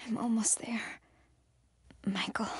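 tearful whisper; a choked hushed call. Acted. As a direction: whisper it near crying. Reaching her goal, close to tears.

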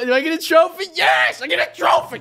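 high, loud triumphant yell; excited shouting. Real, not acted. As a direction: call out to Mom, thrilled. Trophy won, calls out to Mom.

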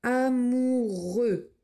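Amoureux stretched out slowly; slow dictation. Shown as a demonstration. Teacher dictating Amoureux very slowly. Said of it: "dictation read slowly".

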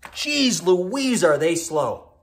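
high, loud exasperated outburst; loud exasperation. Real, not acted. Fire sign fed up with slow earth signs, exasperated. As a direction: burst out at how slow they are.

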